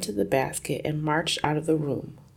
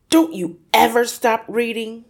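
loud, higher angry snap at his daughter; snapping father; 6 semitones higher, 7 dB louder than usual. Acted. Father snapping at his reading daughter, loud and irritated. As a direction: bark it at the girl who won't stop reading. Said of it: "he snapped at her".

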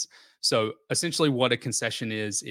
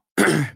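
loud throat clear; 6 semitones higher, 9 dB louder than usual. Real, not acted. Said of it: "Sorry if I'm coughing a lot".

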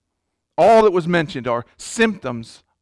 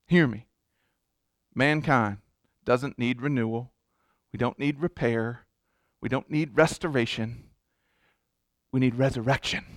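low, soft, earnest preaching; solemn hush; 5 semitones lower, 7 dB quieter than usual. Real, not acted. Preacher telling church mankind needs resurrection, solemn and hushed. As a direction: drop low and quiet to press the point.

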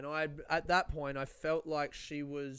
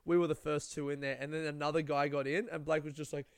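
ordinary talk

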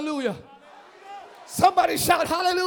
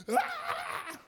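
mock scream; 7 dB quieter than usual. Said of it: "we'd be like".

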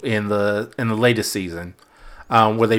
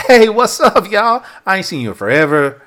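louder, higher, loose greeting to family; 6 semitones higher, 4 dB louder than usual. Shown as a demonstration. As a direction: call out casually to your relatives. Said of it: "If I'm around my family".

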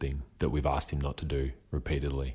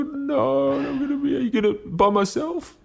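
put-on very high wailing kid protest; crying child; 5 dB louder than usual. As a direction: wail like a toddler who won't go alone. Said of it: "he'll start bawling".

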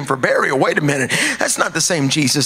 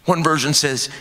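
usual speaking voice

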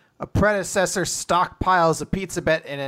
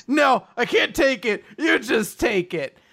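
mock shouted refusal; 7 semitones higher than usual. Asumi refusing the tickets to save her luck, mock shout.

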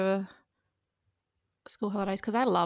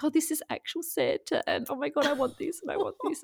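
higher, breathless imitation of her son overwhelmed by Santa; excited little boy; 8 semitones higher than usual. Mother imitating her son meeting Santa, high and excited. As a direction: voice the boy gasping at actual Santa. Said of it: "he's like".

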